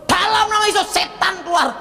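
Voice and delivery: high-pitched mock exorcism command; exorcising preacher. Acts out hamba Tuhan casting out devils.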